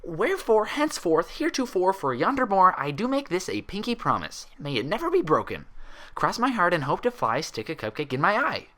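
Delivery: high, hammed-up archaic vow; mock solemn oath. A narrator's reading. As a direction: play the knight swearing with childish pomp. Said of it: "muffled ... talking through a towel".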